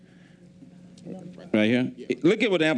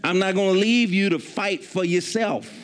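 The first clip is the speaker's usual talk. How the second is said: preaching; 3 semitones higher than usual. Acted. Preacher voicing God's promise.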